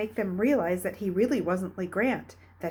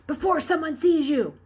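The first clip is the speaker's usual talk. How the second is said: urgent warning; 9 semitones higher, 7 dB louder than usual. Acted. Brain urging him.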